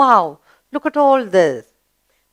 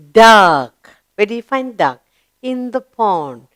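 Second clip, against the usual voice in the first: usual speaking voice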